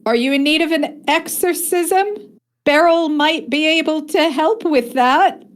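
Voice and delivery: Beryl voice. Acted. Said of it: "says, third person thing".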